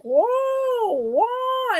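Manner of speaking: high, wobbly drawn-out whoa; two long high-pitched whoas; imitated heaving floor. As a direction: swoop up high like a rolling floor. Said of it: "the floor was like".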